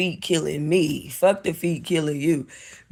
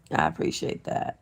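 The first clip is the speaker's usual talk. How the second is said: whispered thank-you; 8 dB quieter than usual. Pretend. Thanking a viewer again, whispered.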